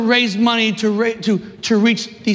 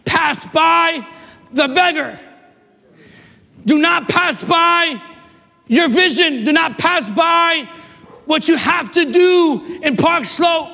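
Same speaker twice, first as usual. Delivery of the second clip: God's command, preached; 7 semitones higher than usual. Retold or imitated. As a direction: thunder each do not pass by like a divine order. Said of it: "But the Lord told me".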